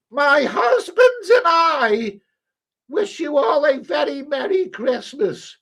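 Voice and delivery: high, slow, hammed-up royal address; mock the Queen's Christmas speech, very high and grand; Queen impression. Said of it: "How does the Queen's Christmas Day speech?".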